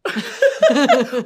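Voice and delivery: big burst of laughter; a real loud laugh. Real, not acted. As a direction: laugh out loud, high and hearty.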